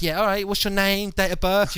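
mock the clerk's quick questions; brisk receptionist. Receptionist quizzing a nervous patient, loud and brisk. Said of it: "She's like".

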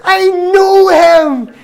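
high, loud, slow squeal of delight; excited Buddy the Elf. Retold or imitated. Imitating Buddy hearing Santa is coming, high excited squeal. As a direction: gush like a thrilled overgrown child. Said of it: "he gets all excited".

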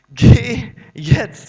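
emphatic command. Acted. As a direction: belt out Geh jetzt as God's order. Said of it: "sagt sie".

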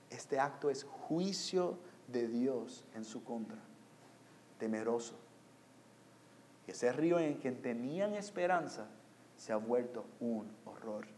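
low, soft pulpit voice; quiet, grave sermon delivery with pauses; solemn hushed preaching. Real, not acted.

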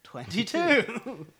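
slow, drawn-out bingo call; bingo caller. Shown as a demonstration. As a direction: stretch the number out like a caller. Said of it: "must do a bingo call for it".

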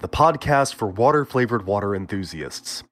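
mock-grand show host intro; podcast announcer voice. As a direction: read it like a pompous radio host.

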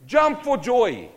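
high, loud and slow; preacher revealing the word's meaning, joyful and loud. Real, not acted. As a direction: savour each word with delight. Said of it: "Guess what word is used there".

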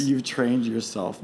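normal talking voice

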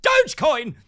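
very high, loud hyped-up yell; crypto bro. Retold or imitated. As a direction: shriek it like an overexcited crypto bro. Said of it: "Crypto bros are like".

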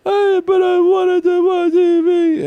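very high, loud, slow whine; whiny kid. Retold or imitated. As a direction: whine like a kid whose TV got switched off. Dad voices son whining again.

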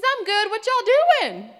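very loud, high, drawn-out imitation; eager friendly guy. Sweet young man wanting a friend, loudly eager. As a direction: belt it out with hammed-up eagerness. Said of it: "He says".

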